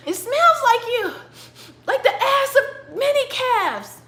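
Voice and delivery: very high, comic bewildered exchange; high-pitched character voice. Acted. Blind father questions son who has just come in, high character voice. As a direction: squeak it out, hammed-up confusion.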